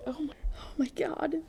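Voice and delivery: high, hushed gasp of disbelief; stunned whisper. Real, not acted. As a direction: whisper it, as if aghast at the story. Stunned reaction to passage just read.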